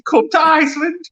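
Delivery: high cheesy slogan voice; advert voice. Pretend. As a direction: sing out the slogan like an ad. Mocking a supermarket slogan.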